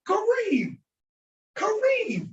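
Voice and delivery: Kareem with a hard English R, pitched very high; mock learner's high Kuh-REEM for Kareem; English R mispronunciation. English speaker mangling an Arabic name, high hard-R Kareem. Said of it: "So he's pronouncing it incorrectly".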